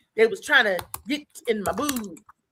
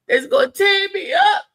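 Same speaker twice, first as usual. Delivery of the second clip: laughing; 9 semitones higher, 6 dB louder than usual. Real, not acted. Laughing climax.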